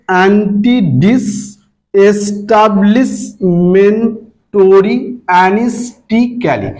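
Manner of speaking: অ্যান্টিডিস এস্টাবলিশমেন্টরি অ্যানিস্টিক্যালি in slow, separate syllables. Shown as a demonstration. Teacher demonstrating pronunciation to students, syllable by syllable. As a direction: sound out each syllable with a small gap. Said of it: "সিলেবালি উচ্চারণ করবে".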